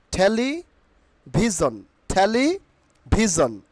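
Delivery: "টেলিভিশন" slowly split, THE-li... BHI-zon; slow two-part "টেলিভিশন": theli, pause, bhizon; corrected pronunciation. Shown as a demonstration. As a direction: say "টেলিভিশন" with puffed t, bh and a z. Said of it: "Not টেলিভিশন".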